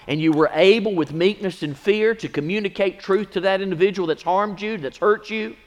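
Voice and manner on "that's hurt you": usual speaking voice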